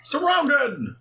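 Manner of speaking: high-pitched, hammed-up cry of alarm; alarmed game character. Dubbing police facing the monster, alarmed. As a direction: call it out high like a cornered cartoon cop.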